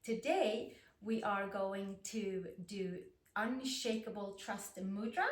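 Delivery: usual speaking voice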